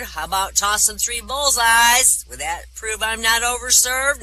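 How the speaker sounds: lightly voiced drunk, dragging the words out. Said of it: "anybody this drunk".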